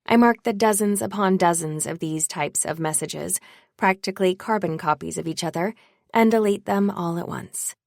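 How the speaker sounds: plain reading voice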